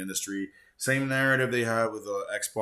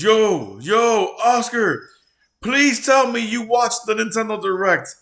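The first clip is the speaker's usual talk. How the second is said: excited; 12 semitones higher, 11 dB louder, slower than usual. Retold or imitated. Friend's excited message read out.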